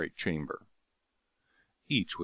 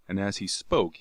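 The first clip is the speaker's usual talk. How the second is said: ordinary delivery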